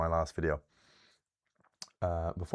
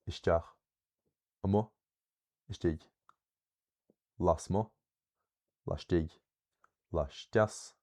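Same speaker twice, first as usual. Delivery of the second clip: each item from "an ún" to "a mú" weighted on its later syllable; light openings as in "amach" and "isteach", punch what follows, pausing between items; stress on second syllable; 3 dB louder, slower than usual. Shown as a demonstration. Teacher reading down the word list, second-syllable stress. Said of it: "secondary stress".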